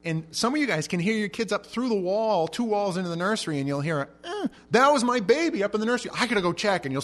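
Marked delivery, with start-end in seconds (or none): 4.2-6.8 much higher and louder startled mom; alarmed mom. Retold or imitated. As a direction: play a mom who just heard her baby. Mom hearing baby.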